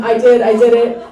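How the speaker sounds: usual speaking voice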